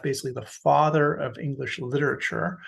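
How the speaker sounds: ordinary delivery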